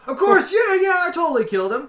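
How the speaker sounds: high, loud, rushed mock boast; hammed-up bragging. Game master parodies a dwarf's false claim of a kill, hammed-up bragging. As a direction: blurt it out high and fast, overdoing the confidence.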